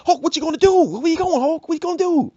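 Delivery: high, pushy instigator stirring drama; hype man. Acted. Instigator calling after Hulk.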